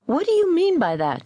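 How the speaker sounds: high-pitched demanding question; indignant demand. A narrator's reading. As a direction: demand an explanation, pitch jumping up. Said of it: "demanded Fuller".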